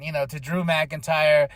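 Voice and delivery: normal talking voice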